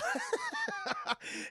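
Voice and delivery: squealing high laugh. Real, not acted. Laughing at his own teacher bit, high squealing laugh. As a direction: burst into a shrill laugh.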